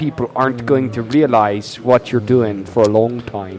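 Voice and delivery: put-on deliberate comic Swedish accent; mock Swedish-accented English. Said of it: "going to do a Swedish accent".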